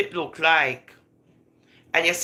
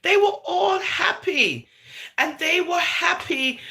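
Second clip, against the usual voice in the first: choked up; 11 semitones higher than usual. Real, not acted. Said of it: "crying heard while reporting".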